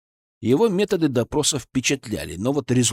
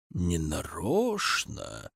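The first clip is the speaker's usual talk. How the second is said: slow, low, much softer sarcastic echo; 6 semitones lower, 9 dB quieter, much slower than usual. A narrator's reading. Colonel sarcastically echoes the merchant's excuse. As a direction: throw his words back at him mockingly. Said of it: "с сарказмом спросил Зотов".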